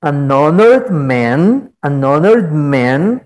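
"An honored man" said slowly, with a silent h on "honored"; slow model phrase. Shown as a demonstration. Teacher modelling "an honored man" slowly for the students.

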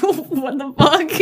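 mock panicked disbelief; shocked what the fuck. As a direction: drag it out in mock alarm. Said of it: "And you're like".

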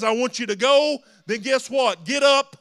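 rally the church to its feet; preacher shouts the congregation up to go; shouting exhortation. Acted.

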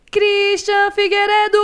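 slow, loud, high showbiz announcement; announcer call. Acted. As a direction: present him like a star. Announcing the co-host. (anunciando com pompa)

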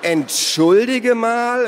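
sehr hoch, laut und gedehnt empört. A narrator's reading. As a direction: Naru wehrt sich gegen den Einsatz. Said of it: "rief Naru empört". (empörter, hoher, gedehnter Ausruf)

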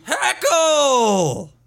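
very high, long mock shout; drawn-out high cry that falls away at the end; retold heckler. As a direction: imitate a man hollering out a heckle. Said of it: "stands up and goes".

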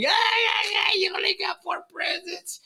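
very high, loud taunting shout that drops to a whisper; shrieking schoolyard taunt, then hissed; mocking kid sister. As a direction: jeer like a spiteful little sister. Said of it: "she started making fun of me".

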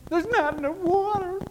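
high, loud whiny Israelites; mock the grumbling people in a high whine; whining complaint. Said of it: "Do you remember?".

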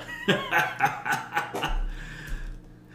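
high-pitched laugh. Acted. NPC laughs.

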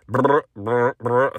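low rolled vibrating burr; lip-buzzed phone vibration; imitated phone buzz. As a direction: mimic a phone buzzing over and over. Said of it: "phone just going".

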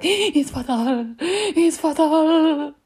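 high, loud, drawn-out wail; hammed-up dying-mum cry; panicked mum impression. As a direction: gasp and wail it like a melodramatic mother. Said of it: "And the way she said it".

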